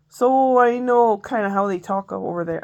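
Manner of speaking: slipping into a Canadian accent. Real, not acted. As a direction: drift unintentionally into Canadian vowels. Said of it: "now I'm going into a Canadian accent".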